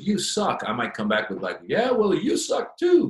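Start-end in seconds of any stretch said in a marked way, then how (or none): 0.0-0.6 higher mock heckler jab; heckler's jeer. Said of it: "if somebody goes". 1.7-3.1 higher, louder, slower, smiling retort; smiling comeback. Retold or imitated. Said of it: "with a smile on my face".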